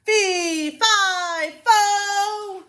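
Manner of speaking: high, loud booming giant chant; bellowing giant. Acted. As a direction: boom each syllable like a giant.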